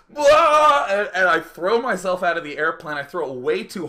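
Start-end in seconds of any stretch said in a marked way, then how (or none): 0.1-0.8 loud, high, drawn-out blurt; wordless yell of flinging yourself out; wild yell. Retold or imitated. Jumper throwing himself from the airplane, wild high yell.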